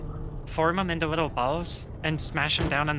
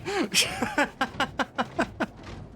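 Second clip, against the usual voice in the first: high staccato laughing fit; real high, choppy laugh; laughter; 9 semitones higher than usual. Real, not acted. Cracked up by a chat comment, high staccato laughter.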